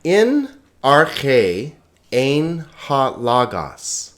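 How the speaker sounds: slow, deliberate Greek reading with long pauses; careful, drawn-out recitation, clearly stressed syllables; measured read-along. Shown as a demonstration. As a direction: lead learners aloud word by word. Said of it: "sort of medium pace".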